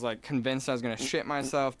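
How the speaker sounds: ordinary delivery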